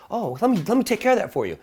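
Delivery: high, fast, eager-to-please waiter voice. Retold or imitated. Waiter apologizing to a diner, high and eager. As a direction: play the waiter rushing to fix it.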